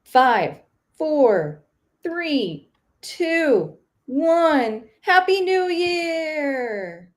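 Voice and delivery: high, loud countdown cheer; new year countdown crowd. Pretend. Mock countdown before webinar starts.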